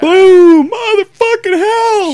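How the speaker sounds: high, loud hammed-up yelp of pain; mock shocked yell. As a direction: scream like the gun just went off. Acting out the accidental gunshot.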